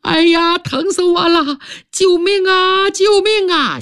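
high-pitched wails for help, stretched out; a wounded man howling from outside the door; crying out in pain. Acted. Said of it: "门外有人大叫起来". (门外伤者高声呼救)

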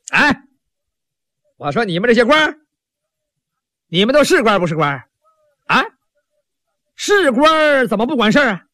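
a hot-tempered street vendor scolding the officials; angry outburst. Acted. Vendor Tang scolding the silent officials, angry heckling. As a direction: heckle them indignantly, rising in pitch. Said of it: "他火了".

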